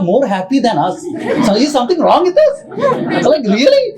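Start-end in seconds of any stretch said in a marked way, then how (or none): 1.5-3.9 high, laughing mock question; laughing disbelief. Real, not acted. Joking parents happier than us.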